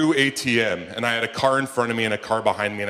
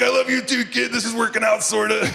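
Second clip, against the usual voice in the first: laughing reply; 11 semitones higher than usual. Retold or imitated. Dad answering vomiting kid.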